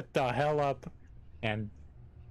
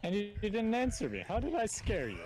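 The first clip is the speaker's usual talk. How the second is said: ordinary talk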